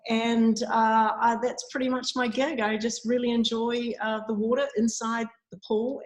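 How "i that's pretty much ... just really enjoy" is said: ordinary delivery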